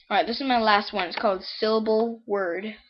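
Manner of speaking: ordinary talk, nothing marked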